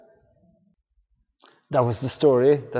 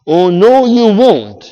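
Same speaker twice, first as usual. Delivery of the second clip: indignant hen; 4 semitones higher, 11 dB louder, slower than usual. Acted. Hen refuses in story.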